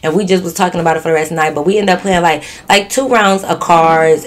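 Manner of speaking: ordinary delivery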